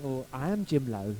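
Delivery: low-pitched, careful reply; polite, understated self-introduction. Retold or imitated. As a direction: break it to them delicately, pitched low. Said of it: "How do I phrase this to them? How do I say it subtly without offending the person?".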